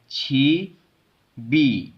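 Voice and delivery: छी बी slowly, syllable by syllable; carried-over consonant. Shown as a demonstration. Teacher shows the carry-over rule, slow linked छी बी. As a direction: open छी, then बी starting with a b sound. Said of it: "दूसरी आवाज़ से पढ़ा जाएगा".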